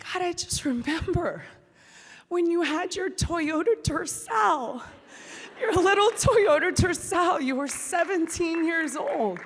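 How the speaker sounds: high, wistful, emotional recollection; imitating crying mom. Mom recalling her daughter at seventeen, mildly tearful imitation. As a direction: act out a tearful mom remembering. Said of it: "like, crying".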